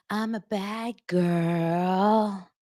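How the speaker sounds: put-on very slow, teary little-girl whine; mock whimper. Taylor answering the yelling Travis with a mock teary whimper. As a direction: fake sob it out.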